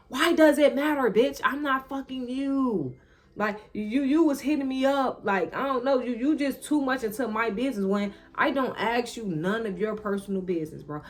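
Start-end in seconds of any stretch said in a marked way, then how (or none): 0.1-5.2 higher, louder snap at the ex; indignant clapback; sharp retort. Retold or imitated. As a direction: bite back at him, loud and high. Snapping back at nosy ex.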